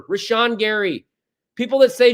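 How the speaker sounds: ordinary delivery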